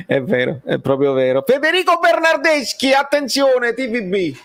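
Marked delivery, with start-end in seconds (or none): 1.5-4.4 high raised call. Real, not acted. Calling out player by name.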